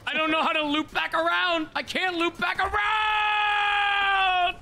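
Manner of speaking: high, slow, drawn-out panic yell; panicked shout. Real, not acted. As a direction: scream it while losing control. Panicking while flying jet.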